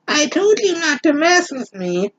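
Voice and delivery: high, pointed warning; high menacing girl voice; threatening heroine. Acted. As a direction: put the witch in her place. Macy warns the witch.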